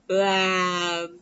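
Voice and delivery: imitated inner wail, loud at the end; mock crying groan. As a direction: let out a hammed-up sob of misery. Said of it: "this is all inside".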